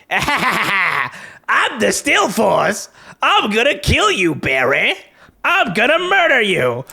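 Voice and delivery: high, slow hammed-up villain threat; mock menacing villain. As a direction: overact a cheesy villain threatening Barry. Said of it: "He's just like".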